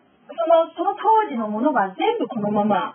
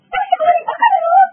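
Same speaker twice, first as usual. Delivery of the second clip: high, loud, slow scolding yell; mother yelling; 8 dB louder, slower than usual. Retold or imitated. Mother yelling at her child, loud scolding yell. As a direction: scream it down at a child. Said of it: "あなたのお母さんが叫んだ".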